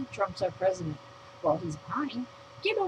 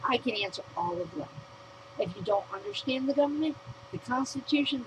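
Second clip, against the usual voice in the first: usual speaking voice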